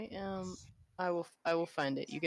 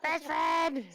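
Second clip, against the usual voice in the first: loud, high greeting; high, gleeful shouting; excited shout; 10 semitones higher, 6 dB louder than usual. Real, not acted. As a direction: holler happily to your best friend.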